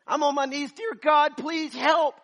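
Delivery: high, loud, desperate cry to God; a husband screaming for help as his wife stops breathing; screamed prayer. Retold or imitated. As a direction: scream the plea like a drowning man. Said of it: "I was screaming like".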